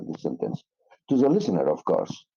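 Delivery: normal talking voice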